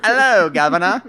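loud, high mock-Cockney British; bad British accent. Voicing the rescued agent, loud hammed-up British accent. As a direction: belt out a comic British greeting. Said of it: "he was like".

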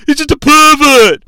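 high, loud mock outburst; British Bulldog. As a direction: imitate an indignant wrestler's accusation. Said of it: "British Bulldog saying to Shawn".

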